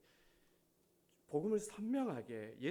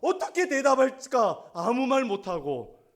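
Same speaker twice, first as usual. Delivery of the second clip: loud, high-pitched preacher's shout; full-force preaching; 9 semitones higher, 18 dB louder, slower than usual. Real, not acted. Preacher rebuking timid Christians, full-force shout. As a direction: drive every phrase out hard and high.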